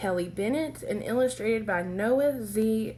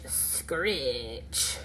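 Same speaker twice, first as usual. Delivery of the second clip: creepy screech; 8 semitones lower, 4 dB quieter than usual. Acted. Imitated noise at window.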